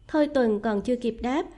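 usual speaking voice

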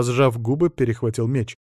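plain reading voice

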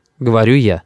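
usual speaking voice

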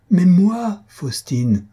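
high, loud appeal that drops on the name; pleading interruption. A narrator's reading. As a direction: break in, begging to be considered. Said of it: "interrompit Mario avec un geste suppliant".

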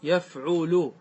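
يفعل said yaf-'U-lu with every vowel; pattern sounded out. Shown as a demonstration. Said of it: "على وزن". (نطق «يفعل» على الوزن: يَفْعُلُ)

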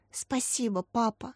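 hushed, touched thanks; soft and tender, barely voiced; moved whisper. A narrator's reading. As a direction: murmur it very softly, deeply moved. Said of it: "прошептала растроганная Катя".

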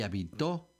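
habitó, final TÓ punched; ha-bi-TÓ, stressed at the end; stress on last syllable. Shown as a demonstration. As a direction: land hard on the O of habitó. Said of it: "con acento en la O".

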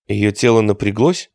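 usual speaking voice

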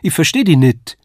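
calling out. A narrator's reading. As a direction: cry out, not understanding. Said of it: "rief sie".

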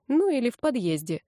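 ordinary delivery

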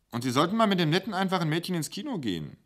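a kindly waitress fussing over him; motherly waitress. Acted. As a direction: sorgende Kellnerin, höher und schnell. Wulla voiced again.

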